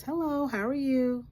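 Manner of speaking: chirpy, high hello to a stranger; sunny customer greeting; bright greeting. Retold or imitated. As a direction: sing out a friendly hello. Cheerful greeting to silent cashier.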